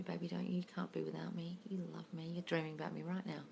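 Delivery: soft affirmation. Shown as a demonstration. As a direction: murmur it softly beside him. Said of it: "Think that while you're lying there".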